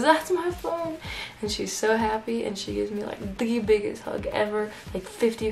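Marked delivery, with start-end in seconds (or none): 0.0-1.0 relieved exclamation. Retold or imitated. Lady recognizes her lost phone.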